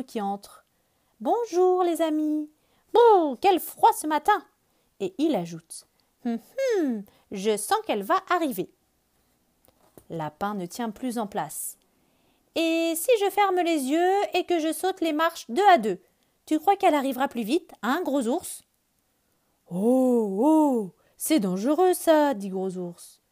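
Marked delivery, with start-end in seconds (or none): 1.2-4.5 cheerful bird. Acted. Bird character greets friends. 6.2-8.7 bird voice. Acted. Bird character speaks. 12.5-18.6 eager rabbit. Acted. Rabbit character asks bear. 19.7-22.3 worried bear. Acted. Bear warns rabbit.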